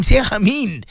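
usual speaking voice

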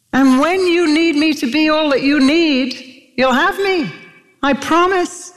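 high-pitched, urgent sermon delivery; impassioned preaching. Real, not acted. Preacher urging the congregation with God's promise, impassioned preaching.

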